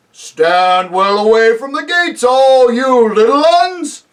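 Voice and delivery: loud, slow giant's warning; booming giant. Acted. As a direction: boom a slow warning to the crowd. Giant warns crowd off the gates.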